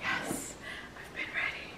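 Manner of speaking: soft voiceless whisper; eager but hushed. Real, not acted. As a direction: barely breathe the answer. Whispered answer near sleeping baby.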